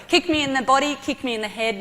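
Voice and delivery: normal talking voice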